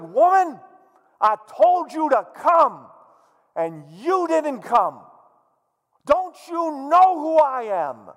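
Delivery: loud, high, slow angry demand; mock outrage from a tyrant husband; furious king. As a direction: bellow at her like an affronted king. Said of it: "he becomes furious".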